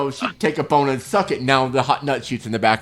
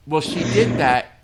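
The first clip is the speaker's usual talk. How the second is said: normal talking voice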